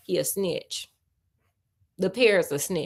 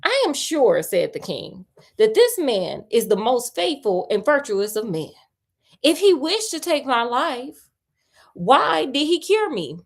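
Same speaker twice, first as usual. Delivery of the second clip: storyteller reading aloud, a little louder; story narration; 5 semitones higher, 3 dB louder than usual. A narrator's reading. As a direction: read the tale to a friend, a touch dramatic. Reads the tale aloud.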